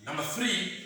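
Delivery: normal talking voice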